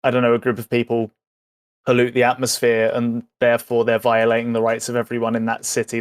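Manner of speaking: ordinary talk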